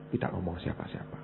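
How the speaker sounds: hushed secret whisper. Retold or imitated. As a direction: whisper it like nobody may know. Berbisik rahasia. (berbisik seperti rahasia)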